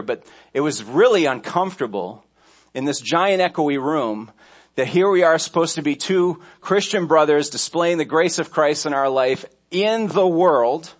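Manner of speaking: ordinary talk, nothing marked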